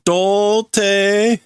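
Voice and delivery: high sing-song insult; mock taunt. Character taunting Ichigo, high sing-song jab. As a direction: tease him with it.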